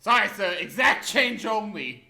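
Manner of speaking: loud, slow, curt cashier refusal; bored irritated clerk. Pretend. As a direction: play a fed-up shop clerk. Cashier refusing customer's backflips.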